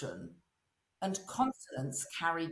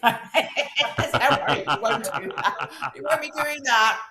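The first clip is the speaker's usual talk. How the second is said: laughing through the words, louder; laughing reassurance, louder; 5 semitones higher, 8 dB louder, slower than usual. Real, not acted. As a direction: giggle while reassuring.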